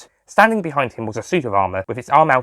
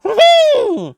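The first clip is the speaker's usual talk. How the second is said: loud, high musing hum; 7 dB louder than usual. Acted. Master hums.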